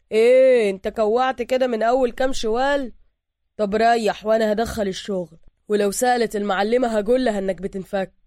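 casual street voice; worker's voice. Acted. Worker brushing off his colleague, casual and loud.

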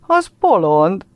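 high, loud mocking call; girls jeering. A narrator's reading. Girls in the gang mock the boy, loud jeering. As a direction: jeer at him like a pack of girls. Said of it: "viognak a csapatban áldogáló lányok".

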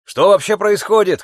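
high, loud panicked yell; panicked shout. A narrator's reading. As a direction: shout it in alarm. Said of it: "крикнул кто-то из пассажиров".

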